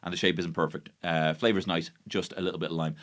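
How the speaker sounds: ordinary talk, nothing marked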